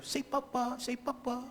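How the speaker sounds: put-on soft, high baby-talk coaxing. Friend urging a baby to say papa, soft coaxing.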